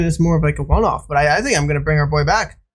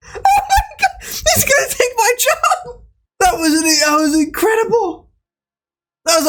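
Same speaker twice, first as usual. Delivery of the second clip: very high laughing disbelief; squealing laugh. Real, not acted. Streamer amazed at the AI's outro, laughing high. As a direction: shriek with delighted laughter.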